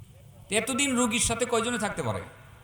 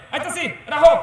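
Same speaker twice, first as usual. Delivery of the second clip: curt brush-off; 9 semitones higher, 11 dB louder than usual. Retold or imitated. Son brushing off mother's call.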